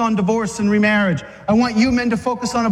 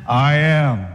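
"I am" said low with a manly swagger, lower than his own voice; swaggering Jesus; 6 semitones lower than usual. Acted. Jesus answering the arresting horde with swagger. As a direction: stand tall and own the words. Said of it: "He had the man swagger too".

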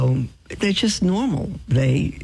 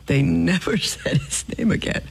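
laughing. Real, not acted. Repeats punchline laughing.

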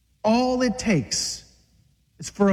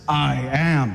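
swagger; 7 semitones lower than usual. Acted. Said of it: "He had the man swagger too".

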